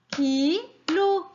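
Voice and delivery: KILO drawn out as KI, pause, LO; split into syllables. Shown as a demonstration. Teacher has children count along, KILO broken into two beats. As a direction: say KILO syllable by syllable, each one held. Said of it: "il y a combien de syllabes".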